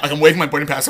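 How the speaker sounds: ordinary talk, nothing marked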